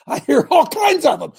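loud, high burst of admission; emphatic exclamation. Real, not acted. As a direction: exclaim it with a laugh, voice jumping up. Preacher escalates his own repeated admission.

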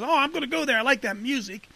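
high, eager voice of the Spirit; Holy Ghost voice. Retold or imitated. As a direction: lightly play a delighted Spirit drawn to music. Holy Ghost voiced attracted by music.

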